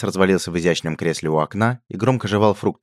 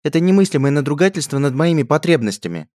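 high, loud outraged princess; spoiled royal in full tantrum; indignant princess; 10 semitones higher, 6 dB louder than usual. A narrator's reading. Princess raging to the lackey, high loud tantrum.

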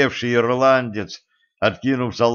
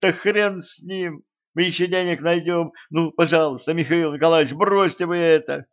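high, desperate begging; pleading; 7 semitones higher than usual. A narrator's reading. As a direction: beg him to stop. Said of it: "Женька взмолилась".

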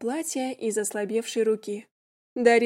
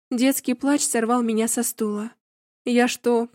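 ordinary delivery

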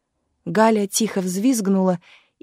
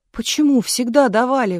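higher, louder indignant protest; 4 semitones higher, 5 dB louder than usual. A narrator's reading. Klavdia protesting about Sunday rations, indignant. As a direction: object as if cheated. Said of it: "возмутилась Клавдия".

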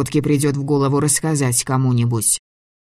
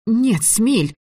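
much higher, shaky firm no; trembling voice; 9 semitones higher than usual. A narrator's reading. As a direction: try to sound firm, voice trembling. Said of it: "пыталась сказать это решительно, но голос дрожал".

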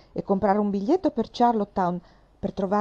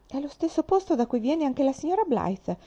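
a higher exclamation of surprise; exclaiming; 7 semitones higher than usual. A narrator's reading. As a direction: blurt it out, amazed at the coincidence. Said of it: "esclamò Faith". (Faith esclama sorpresa e entusiasta)

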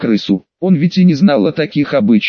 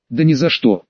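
plain reading voice

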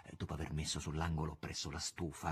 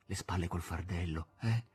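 tearful whisper; 8 semitones higher than usual. A narrator's reading. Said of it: "gli sussurrava".

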